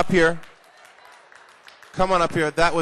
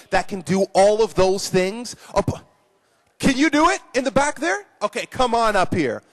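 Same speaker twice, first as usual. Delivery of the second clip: ordinary delivery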